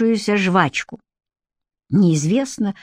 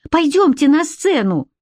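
kindergarten teacher; 7 semitones higher than usual. Acted. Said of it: "как говорят малышам воспитатели".

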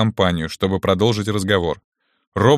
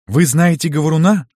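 put-on higher, louder surprised girl; girl's voice; 6 semitones higher, 4 dB louder than usual. A narrator's reading. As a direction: ask it as a startled young girl. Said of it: "спросила Алиса". (удивлённый девичий голос)

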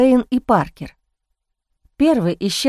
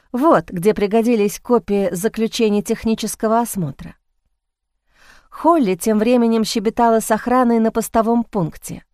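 usual speaking voice

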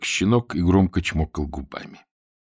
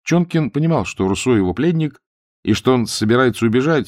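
ordinary delivery, nothing marked

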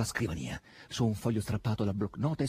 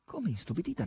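ordinary delivery

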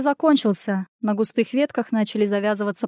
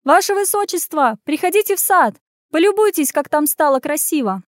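mock-sweet call to the prince, raised high; 6 semitones higher than usual. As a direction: shout it sweetly across the grounds. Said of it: "крикнула".

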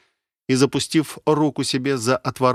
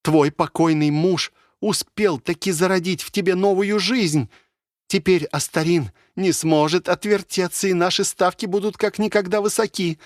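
high-pitched, triumphal announcement; solemn proclamation; 8 semitones higher than usual. A narrator's reading. Mother announcing the pregnancy to her daughter, solemn and exalted. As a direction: proclaim it ceremoniously in a raised mother's voice. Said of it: "воскликнула она торжественным тоном".

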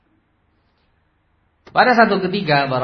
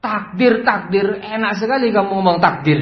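high, loud mocking retort; acted refusal to accept it; indignant boss; 7 semitones higher, 5 dB louder than usual. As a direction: throw the excuse back scornfully. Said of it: "Nggak bisa terima".

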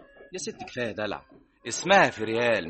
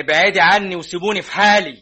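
loud, higher furious outburst; husband yelling at his meddling mother-in-law; angry shout; 8 semitones higher, 9 dB louder than usual. Acted. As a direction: shout her away.

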